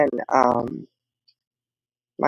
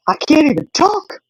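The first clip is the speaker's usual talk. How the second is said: put-on higher, slow child's complaint; whiny kid protest; 12 semitones higher, 3 dB louder than usual. Daughter protesting being shushed, high whiny child voice. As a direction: play the indignant little girl.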